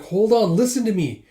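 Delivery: urgent plea. Acted. Ryu trying to calm merchants.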